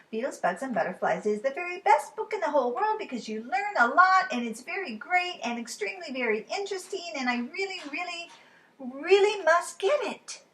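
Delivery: put-on high, pleading little girl; really, really wanting it; Lola voice. Lola pleading with Charlie in the library, high.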